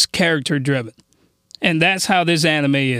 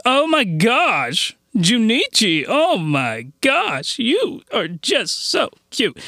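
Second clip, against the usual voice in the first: put-on drawn-out, higher Valley Girl gush, slow; Valley Girl accent; 4 semitones higher, much slower than usual. Reviewer imitating the anime girl's Valley Girl talk, slow and higher. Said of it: "like a Valley Girl accent".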